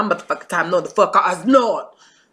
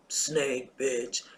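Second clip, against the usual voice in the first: whispering; 5 semitones lower, 12 dB quieter than usual. Real, not acted. Whispered insult in rant.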